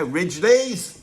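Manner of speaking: mock rallying call, building to a loud shout; playful shout into the crowd. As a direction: summon the Ridgeleys at the top of your voice. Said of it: "I'm gonna go and find one".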